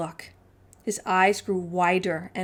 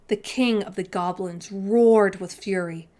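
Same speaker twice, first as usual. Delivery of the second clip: usual speaking voice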